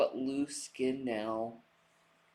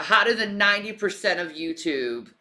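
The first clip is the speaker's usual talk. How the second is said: quoting a vain boast; 4 semitones higher, 7 dB louder, faster than usual. Retold or imitated. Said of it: "she said that".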